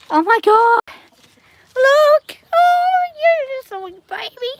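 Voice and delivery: delighted coo; excited cooing. Real, not acted. Cooing at a newborn.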